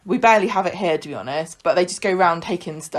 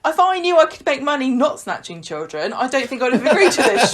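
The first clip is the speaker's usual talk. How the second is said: higher, giggling mock complaint; laughing through it; 4 semitones higher than usual. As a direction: say it while laughing at the idea. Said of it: "He's like".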